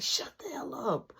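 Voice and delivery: hushed, fast whisper; hissed whisper at little brother; whispered shut up. Retold or imitated. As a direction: whisper it sharp. Hiding, hissing at brother.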